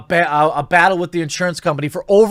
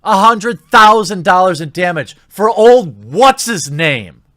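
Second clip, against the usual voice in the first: sarcastic; 3 semitones higher, 6 dB louder than usual. Real, not acted. Sarcastic jab at protesters.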